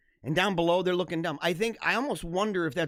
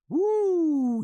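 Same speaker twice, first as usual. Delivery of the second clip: a high, loud woo; excited whoop; 11 semitones higher, 5 dB louder than usual. Real, not acted. After killing the chicken, a high loud whoop.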